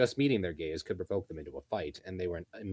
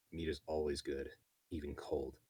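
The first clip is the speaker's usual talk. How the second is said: low, soft blunt grunt; gruff beast woman; 3 semitones lower, 7 dB quieter than usual. Acted. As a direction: flatly state it. Ghislaine voiced low.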